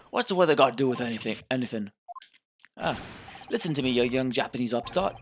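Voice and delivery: irritated, gruff British policeman; gruff British inspector. Acted. Inspector rebukes young Japanese visitor, gruff and irritable British. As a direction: scold in a gruff British accent. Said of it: "Gruff, gruff British voice".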